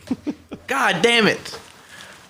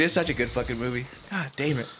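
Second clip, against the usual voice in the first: ordinary talk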